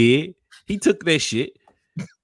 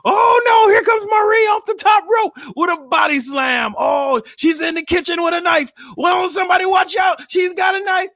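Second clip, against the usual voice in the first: wrestling commentator. Retold or imitated. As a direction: call it like a ringside announcer. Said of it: "Then here she comes".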